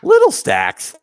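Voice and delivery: very high, loud playful squeal; squealed mock echo. Host echoing co-host's "little stacks", high squealed mock echo. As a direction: echo it in a silly falsetto.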